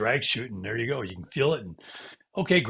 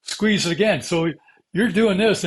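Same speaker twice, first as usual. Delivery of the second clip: ordinary talk, nothing marked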